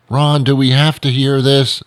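high-pitched mock complaint; complaining listener. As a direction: mimic a reluctant listener butting in. Voices a complaining listener interrupting.

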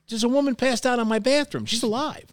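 high, strained rush of words; man explaining to the cop about a passed-out woman, high and frantic; panicked retelling. Retold or imitated.

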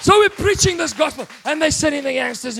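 fired-up preaching. Real, not acted. Preacher telling the church about the gangsters, loud and high. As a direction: lift the crowd with it. Preacher building to full force.

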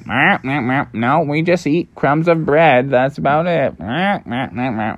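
duck talk framed by short quacks; quacking ducks. Acted. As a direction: answer as a pond duck, quacking. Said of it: "And they're like".

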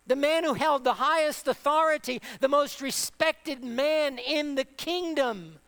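usual speaking voice

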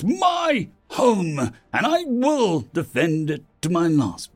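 high, impassioned declaration building to a command; rally the room with fierce resolve; impassioned leader's high-pitched battle cry; defiant rallying speech. A narrator's reading.

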